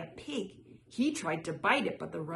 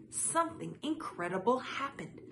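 plain reading voice

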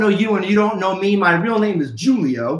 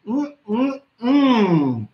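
savoring hum. Real, not acted. Sipping lemonade.

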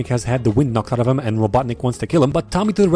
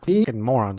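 ordinary talk, nothing marked

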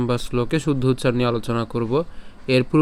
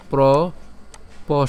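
Props stretched out very slowly; much slower than usual. Shown as a demonstration. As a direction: draw out props. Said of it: "এভাবে উচ্চারণ করতে পারি". (Props খুব ধীরে টেনে)

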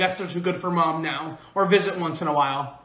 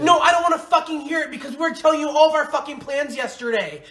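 angry yelling; 6 semitones higher than usual. Acted. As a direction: chew him out at full volume. Yelling at friend who overslept.

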